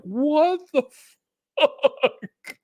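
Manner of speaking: high, slow, laughing What the fuck; drawn-out laughing outburst of disbelief. Retold or imitated. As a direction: stretch it out through a laugh. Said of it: "And I'm like".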